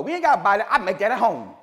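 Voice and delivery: imitating Mama. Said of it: "Mama go".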